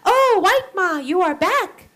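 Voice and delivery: put-on high, bright child's greeting; excited boy. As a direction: call out like an eager little boy. Boy greets returning missionary.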